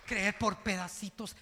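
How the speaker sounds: loud, soaring preacher's proclamation; impassioned pulpit cry; fiery preaching. Acted. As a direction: shout the conviction to the church. Pastor preaching at full force.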